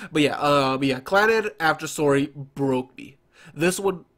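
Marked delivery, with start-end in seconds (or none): none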